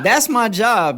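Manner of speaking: high, loud words bursting through laughter; laughing protest. Real, not acted. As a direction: defend yourself while cracking up. Laughs while defending the bit.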